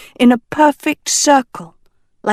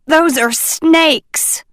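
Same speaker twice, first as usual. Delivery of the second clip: a slow, higher mild squawk; 8 semitones higher, slower than usual. A narrator's reading. A professor alarmed at snakes in the cave, mild squawk. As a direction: let a hint of alarm squeak through. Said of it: "she squawked".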